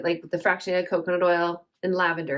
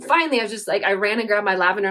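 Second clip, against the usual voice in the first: ordinary talk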